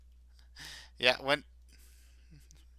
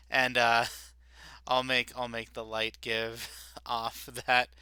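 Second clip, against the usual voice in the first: ordinary delivery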